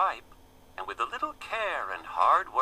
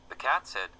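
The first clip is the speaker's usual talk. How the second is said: ordinary delivery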